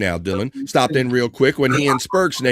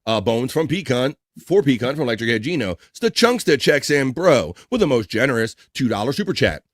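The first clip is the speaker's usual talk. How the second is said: ordinary talk, nothing marked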